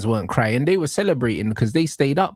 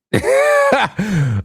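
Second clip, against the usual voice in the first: laughs; 7 semitones higher, 5 dB louder than usual. Real, not acted. Laughing at rival's line.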